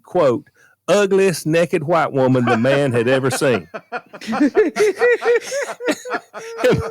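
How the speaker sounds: slow, laughing punchline; barely holding in the laughter; real laughter cracking the punchline; laughing through it. Real, not acted.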